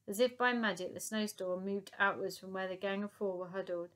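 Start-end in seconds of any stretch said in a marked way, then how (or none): none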